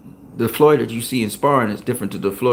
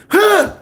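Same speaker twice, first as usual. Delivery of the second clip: high, loud imitated huff; imitated grunt; 5 dB louder than usual. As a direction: act out a kid's sudden loud huff. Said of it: "that little dude did like this".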